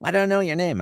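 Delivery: very high, cartoonish voice; Tom Kenny. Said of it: "And he goes".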